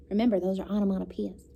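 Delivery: ordinary delivery, nothing marked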